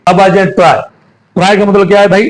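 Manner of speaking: usual speaking voice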